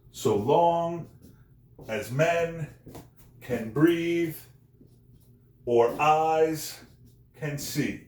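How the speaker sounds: "so LONG, as MEN, can BREATHE, or EYES, can SEE", pausing after each pair; light first word, heavy drawn-out second: "so LONG", "as MEN"; iambic stress pairs. Shown as a demonstration. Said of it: "unstressed, stressed".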